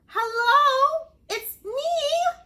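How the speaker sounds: high, loud call; little fish calling to a friend. Acted. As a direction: call out across the water. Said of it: "he called".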